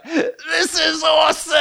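high, drawn-out mock wail; hammed-up crying child; imitated crying. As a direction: wail the words through fake sobs. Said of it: "intense crying".

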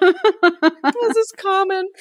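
high, drawn-out mock echo; amused echo. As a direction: repeat it slowly in a high, tickled voice. Echoes co-host's phrase, giggling.

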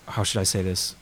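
usual speaking voice

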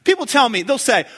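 ordinary talk, nothing marked

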